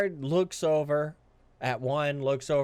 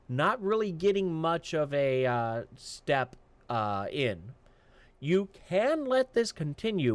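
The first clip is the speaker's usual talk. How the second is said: ordinary talk, nothing marked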